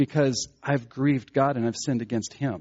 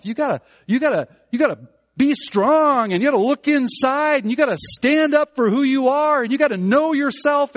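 higher and louder, hammed-up motivational coach; mock pep-talk voice; 10 semitones higher, 6 dB louder than usual. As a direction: sell self-belief like a pushy coach. Voicing the world's pep talk.

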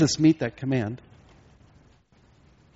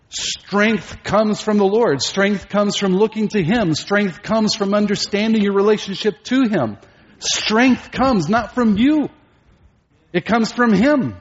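high, driving proclamation; full-force preaching; 8 semitones higher, 5 dB louder, slower than usual. Real, not acted. Preacher tells congregation strength comes from the Lord, full-force preaching. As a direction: preach it out high, hammering each repetition. Sermon climax.

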